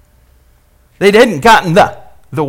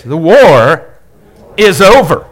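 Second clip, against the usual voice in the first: the war is over, war and over loud and ringing; proclaiming; 6 dB louder, slower than usual. Shown as a demonstration. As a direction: declare it like a victory. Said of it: "say this".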